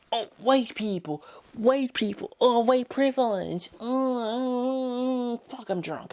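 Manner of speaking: high put-on whine; mocking Twitter user. Vlogger mocks angry Twitter users, high mocking whine. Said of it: "Twitter going".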